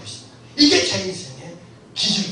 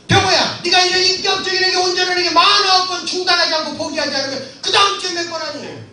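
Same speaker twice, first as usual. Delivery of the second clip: higher, loud, shouted divine question; God's voice, louder; 7 semitones higher, 5 dB louder than usual. Retold or imitated. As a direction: call it out as God pressing him. God's inner voice, repeated louder.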